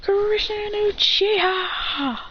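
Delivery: high, very slow winner announcement; high drawn-out referee call; announcer call. Acted. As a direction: announce it to the crowd. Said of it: "He says".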